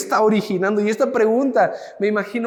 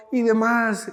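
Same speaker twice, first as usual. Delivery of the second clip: ordinary delivery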